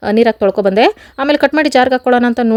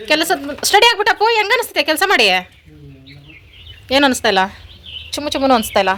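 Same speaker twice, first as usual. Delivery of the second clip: higher, sing-song voice for the dog; talking to pet; 3 semitones higher than usual. Real, not acted. Teasing her dog about work, high pet-talk voice. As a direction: coo teasingly at the pet, pitched up.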